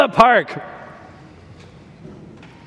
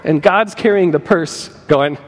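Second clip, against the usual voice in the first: ordinary delivery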